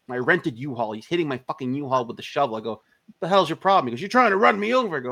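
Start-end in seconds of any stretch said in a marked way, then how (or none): 3.1-3.9 raised, louder indignant challenge; retold angry challenge; mock indignant. Acted quarrel over hit van. 4.0-5.0 high, louder retold outburst; heated accusation, acted out; mock angry accusation. Shovel man accuses him, acted.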